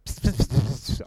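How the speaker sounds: little hissed psst sounds like an ant; ant talk. Retold or imitated. Pastor imagining he talks as an ant, playful psst. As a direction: pretend to be an ant whispering to others. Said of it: "if I was to become an ant and go".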